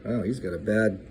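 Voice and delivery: plain reading voice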